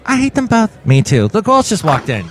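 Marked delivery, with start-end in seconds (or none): 0.0-0.7 high character voice. Pretend. Jelly character chimes in again.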